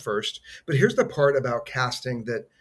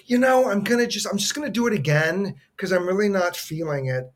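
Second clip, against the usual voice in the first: higher, louder mock of a fussy actor restarting; imitating his twin; 6 semitones higher, 3 dB louder than usual. Twin brother restarting his audition take, higher and louder. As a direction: play the brother stopping himself mid-take. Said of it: "And he goes".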